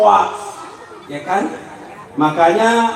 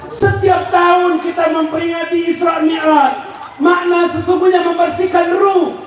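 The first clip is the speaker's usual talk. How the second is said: high, driving preacher's delivery; pressing sermon, pitched high; forceful preaching; 9 semitones higher than usual. Real, not acted. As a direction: preach it out with raised pitch and urgency.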